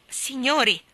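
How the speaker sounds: calling to attention. A narrator's reading. Mayor addresses the two magistrates.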